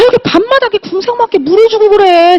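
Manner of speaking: high-pitched exasperated nag, drawn from a retold spat; nagging wife. Wife nags husband in retold quarrel. (높은 목소리로 남편에게 잔소리하듯)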